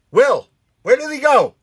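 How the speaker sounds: usual speaking voice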